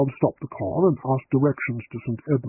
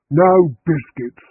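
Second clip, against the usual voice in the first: slow, louder curt snarl; 3 semitones higher, 7 dB louder, much slower than usual. A narrator's reading. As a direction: bite the words off as she leaves. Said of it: "she snarled".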